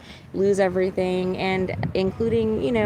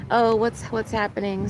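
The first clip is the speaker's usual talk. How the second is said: ordinary talk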